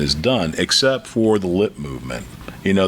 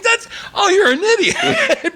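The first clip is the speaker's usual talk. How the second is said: high, slow words through laughter; helpless laughing mock scolding; laughing reproach; slower than usual. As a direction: call him out while cracking up. Laughing retold reply to joke.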